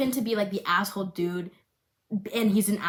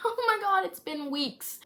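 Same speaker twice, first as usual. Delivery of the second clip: mock shock; 10 semitones higher than usual. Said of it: "and I'm like".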